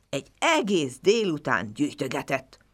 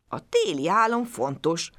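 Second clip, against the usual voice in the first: plain reading voice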